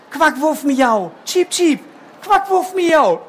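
high, slow little bird call. Acted. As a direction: chirp it out like a tiny bird. Bird's calls.

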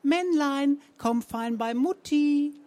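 Zenker impression. A narrator's reading. Romanow lures the poodle in Frau Zenker's voice, high and slow. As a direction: lure the dog as its owner.

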